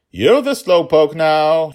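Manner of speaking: high, loud, slow taunting shout; gleeful shout. Acted. Daughter shouting as she passes father, high slow taunt. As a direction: lean on you're as you pass. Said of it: "she shouted".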